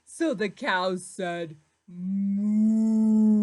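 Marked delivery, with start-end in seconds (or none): 1.9-3.4 much louder, long moo. Acted. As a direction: bellow the moo like a big cow. Cow says moo.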